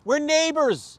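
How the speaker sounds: high, loud protest; retold indignant outburst; exasperated plea. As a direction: cry it out high to the other driver. Acted confrontation with rude driver.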